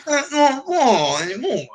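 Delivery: high, slow, dopey voice; high slow dopey mock athlete voice; mocking Eli Manning impression. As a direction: drag it out like a dim jock.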